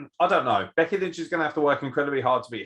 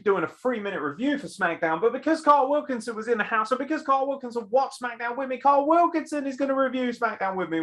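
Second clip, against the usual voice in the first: announcer; 10 semitones higher than usual. Acted. Show intro to audience.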